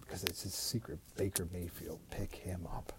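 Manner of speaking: secretive hushed whisper. Real, not acted. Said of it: "Why are you whispering?".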